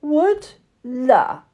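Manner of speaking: high, loud incredulous squeal; mocking high-pitched astonishment; scornful disbelief. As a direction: shriek the word in scorn like a haughty French doll. French doll scoffs in disbelief.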